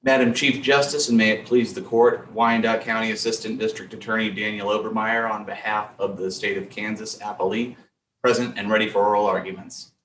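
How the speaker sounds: remote video call. Real, not acted. Remote counsel, different audio.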